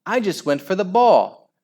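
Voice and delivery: high, loud excuse; screamed protest. A narrator's reading. Al defending himself, screaming protest. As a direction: protest innocence at high pitch. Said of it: "screamed Aerobic Al".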